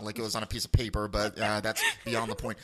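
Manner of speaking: ordinary talk, nothing marked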